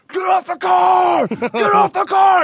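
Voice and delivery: very high, loud, slow barked order; bouncer shout. Retold or imitated. Bodyguard charging at the guy leaning on the car, furious shout.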